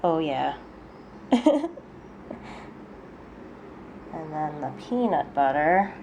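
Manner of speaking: ordinary talk, nothing marked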